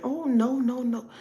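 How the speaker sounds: high, prissy no no no; mock a prude refusing; prudish denial. Mocking prudes who refuse, high prissy denial. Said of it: "don't be around here saying".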